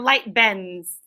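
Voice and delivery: ordinary delivery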